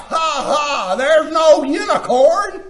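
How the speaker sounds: loud, high imitation of scoffers; mock the mockers with a shouted fake "ha, ha"; mocking laughter. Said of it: "they will mock this translation of a unicorn and laugh".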